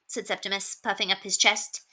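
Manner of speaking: ordinary delivery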